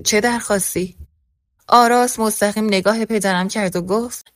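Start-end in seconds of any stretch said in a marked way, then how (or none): none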